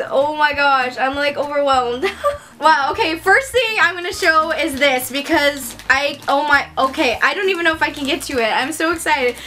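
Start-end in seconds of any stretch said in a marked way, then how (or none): none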